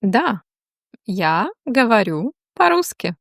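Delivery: normal talking voice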